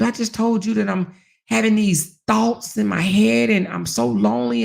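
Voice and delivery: ordinary talk, nothing marked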